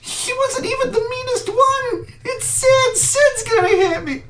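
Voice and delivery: high, trembling panic; panicked kid. Acted. The beaten kid fears the bully will kill him. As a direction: babble in fear of getting hurt.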